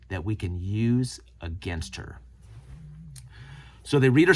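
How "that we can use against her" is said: lower, slow and sly, like a schemer; lightly voiced plotting cop; sinister mock. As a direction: drop into a scheming murmur. Mocking scheming cops.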